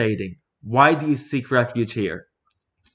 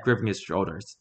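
plain reading voice

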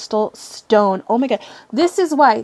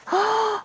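high gasp of awe; sharp intake of delighted surprise; 11 semitones higher, 3 dB quieter than usual. Real, not acted. Reacting to unboxed items.

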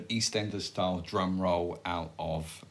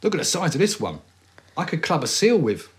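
mock boasting; 7 semitones higher, 6 dB louder than usual. Mock exaggerated show-off.

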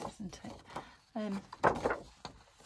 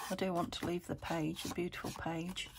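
ordinary talk, nothing marked

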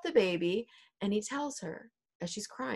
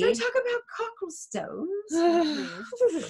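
high, slow, mock-distressed plea; hammed-up prim horror, stretched out; scandalized Lady Capulet; 11 semitones higher, much slower than usual. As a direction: wail it like a fainting gentlewoman. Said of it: "Lady Capulet's all like".

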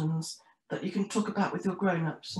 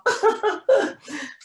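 high-pitched acted giggle; laughing; 12 semitones higher, 5 dB louder than usual. A narrator's reading. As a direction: laugh out loud as two penguin sisters. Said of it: "look at each other again and laugh".

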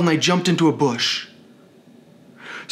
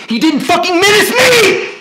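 high, loud, tearful scream; real outraged shriek, pitched very high; 6 dB louder than usual. Real, not acted. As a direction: scream in furious disbelief. Outraged rant about felony charge.

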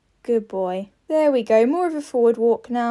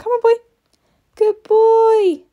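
high, loud praise for the horse; excited praise; 10 semitones higher, 7 dB louder, slower than usual. Real, not acted. Rider cheering her horse at the finish, high and loud. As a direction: cheer him home with joy.

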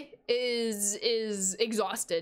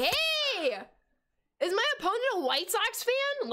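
surprised; 10 semitones higher than usual. Real, not acted. Spots opponent's team, exclaims.

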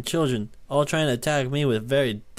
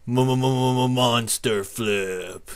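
very slow stuttering trick call; drawn-out announcer-style stammer; stuttered build-up; much slower than usual. Pretend. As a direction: repeat the first sound, then stretch the words out. Stutters announcing a monster flip.

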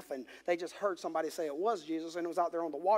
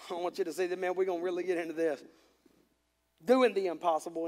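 ordinary talk

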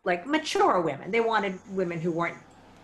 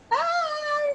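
much higher, breathy-star greeting; starstruck impression. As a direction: squeal a tiny celebrity hello. [name] greets her.

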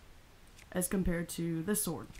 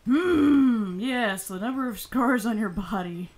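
put-on loud high creepy old man; 3 semitones higher, 8 dB louder than usual. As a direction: savour it like an old pervert. Voicing Robbie examining scars.

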